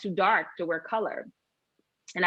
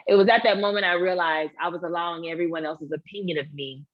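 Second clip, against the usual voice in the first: ordinary talk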